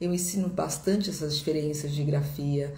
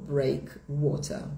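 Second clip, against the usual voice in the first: slow British demo, R dropped at the end of "breakwater"; sotaque britânico; much slower than usual. Shown as a demonstration. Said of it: "Em inglês britânico". (sotaque britânico, devagar, sem o R final)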